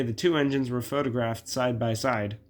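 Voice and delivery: ordinary delivery, nothing marked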